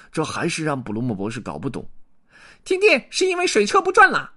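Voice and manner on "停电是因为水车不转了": very high squeaky beaver; lisping buck-toothed beaver. Acted. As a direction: pipe up like a tiny beaver. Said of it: "第二只河狸说". (尖细的小河狸声音)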